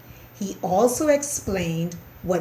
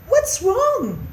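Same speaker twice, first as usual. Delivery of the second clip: mouse mama; 14 semitones higher, 5 dB louder than usual. A narrator's reading.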